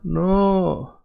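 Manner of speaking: drawn-out dismissive protest. Acted. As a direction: stretch each no in friendly disagreement. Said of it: "they're gonna tell you".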